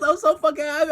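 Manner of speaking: very high, loud overjoyed yell; ecstatic shout. Retold or imitated. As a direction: yell it like you just won. Reliving the joy at full volume.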